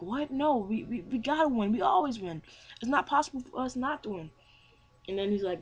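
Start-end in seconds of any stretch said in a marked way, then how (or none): 0.0-4.3 high, protesting disbelief; flabbergasted. Retold or imitated. Hero refusing to accept defeat, flabbergasted. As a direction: protest in acted shock, voice pitched up. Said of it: "he's flabbergasted".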